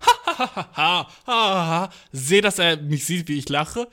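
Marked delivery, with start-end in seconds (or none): 0.0-1.9 fake laughing. Walking down the street, laughing at a podcast. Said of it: "ich geh da so lang auf Lache".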